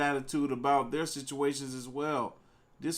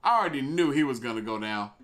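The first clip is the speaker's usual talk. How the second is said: normal talking voice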